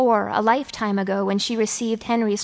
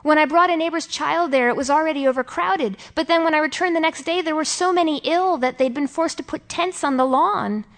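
higher-pitched, eager recounting; eager to confide; 6 semitones higher than usual. A narrator's reading. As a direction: pour it out, hungry to tell someone. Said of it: "hungry to tell someone".

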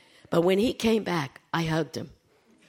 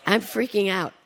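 usual speaking voice

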